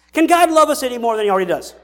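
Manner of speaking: ordinary talk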